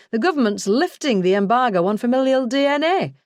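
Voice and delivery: a lightly voiced northern English colleague, eager; chipper northern accent. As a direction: announce it with northern English cheer. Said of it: "in his chipper northern accent".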